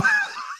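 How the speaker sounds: short, high squeal of laughter; high giggle. Real, not acted. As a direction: break into a quick giggle mid-sentence. Laughs mid-joke about ladies fighting. (ऊँची, छोटी खिलखिलाहट)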